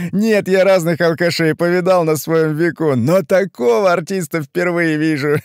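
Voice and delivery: loud, high-pitched amused mockery; speaking through laughter. A narrator's reading. Brother-in-law mocks Ivan as an artist, laughing. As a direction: talk while shaking with laughter. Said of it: "затрясся от смеха".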